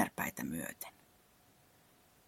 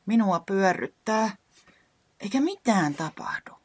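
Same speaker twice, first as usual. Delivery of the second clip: despairing; 7 semitones higher, 5 dB louder than usual. Acted. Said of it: "alkoi olla epätoivoinen".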